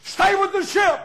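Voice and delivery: high, loud charge to the church; loud shouted sermon line; shouted preaching. Acted. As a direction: call it out like a battle cry.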